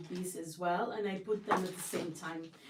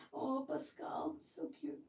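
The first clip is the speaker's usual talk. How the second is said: cooing; 6 semitones higher, 6 dB quieter than usual. Real, not acted. Cooing at her pet.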